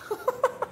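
a short high giggle. Real, not acted. Reader giggling after her mock tough-guy Canadian line, high giggle. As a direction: giggle at your own bit.

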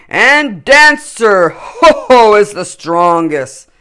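hearty Father Christmas choosing his team; jolly Santa. A narrator's reading. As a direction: boom it out like a merry Santa. Santa's voice in story.

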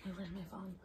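hushed whisper; whisper yelling. Real, not acted. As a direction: whisper so nobody wakes. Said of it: "we're whispering because they're asleep".

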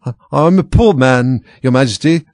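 high, loud, faltering plea; trembling voice. A narrator's reading. Hatter pleading his case to the king, trembling voice. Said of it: "began in a trembling voice".

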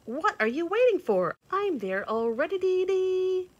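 high, loud impatient birdy call; exclaiming chickadee. A narrator's reading. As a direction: chirp out an excited hurry-up. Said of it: "exclaimed the chickadee".

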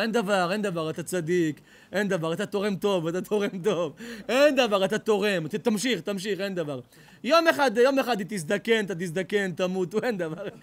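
loud indulgent brushing it all off; hammed-up fawning rabbi. A fawning rabbi excusing a donor's adultery, hammed-up indulgence. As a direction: wave away every sin cheerfully.

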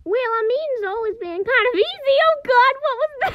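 a high squeaky character voice; high put-on voice. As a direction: pitch it way up, cartoonish. Impression reel voice, high.

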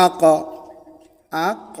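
আক-এর শেষে ক্ এ হালকা প্রতিধ্বনির ধাক্কা; "আক" বলুন, শেষের ক্ যেন প্রতিধ্বনির মতো লাফিয়ে ওঠে; কলকলা করে পড়া. Shown as a demonstration. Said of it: "এই উচ্চারণটাকেই কলকলা বলা হয়". (তাজবীদের কলকলা দেখিয়ে "আক")

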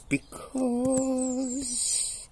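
very high, drawn-out mouse squeak; stalling mouse. Acted. Mouse stalling the hungry lion, high hesitant squeak. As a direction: hold the word high while thinking.

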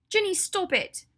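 very high, loud protest; frantic angry shout. Acted. Hermione sees Draco slapped, shouting at her friend to stop. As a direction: cry out to stop the attack.

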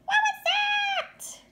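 very high, slow and loud; a startled chick crying out; loud high chirpy question; high baby bird cry. A narrator's reading. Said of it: "cried Flap".

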